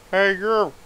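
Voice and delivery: muffled voice through a lead box. A narrator's reading. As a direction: call out as if shut inside a box. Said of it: "There is a muffled voice coming out from up besides the lead".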